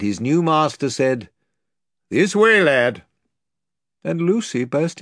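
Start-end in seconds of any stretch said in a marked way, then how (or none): 2.1-3.0 higher, louder brisk call; lordly call. Acted. The lord leading Caspian away, brisk. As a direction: lead the boy off.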